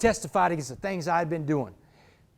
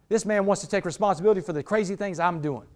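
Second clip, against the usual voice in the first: normal talking voice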